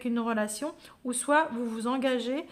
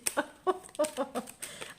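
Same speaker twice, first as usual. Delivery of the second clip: embarrassed laugh; 3 semitones higher, 4 dB quieter than usual. Real, not acted. Laughs after burping.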